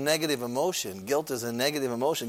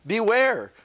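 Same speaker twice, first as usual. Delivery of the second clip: "Beware" raised high with emphasis; emphatic beware; 9 semitones higher, 4 dB louder than usual. Shown as a demonstration. Preacher reading the verse, stressing beware high. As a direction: open the verse with an urgent "Beware". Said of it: "So there's some emphasis".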